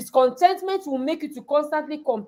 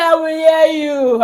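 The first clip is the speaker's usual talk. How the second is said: slow, higher crying whine; imitating crying daughter; 4 semitones higher, 6 dB louder than usual. Daughter crying promise to mother, mock sobbing. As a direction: sob the promise out. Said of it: "She cried".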